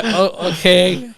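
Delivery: slow, laughing, humoring okay; laughing mock politeness. Teller humoring the proud customer, slow and laughing. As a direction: humor her through a laugh.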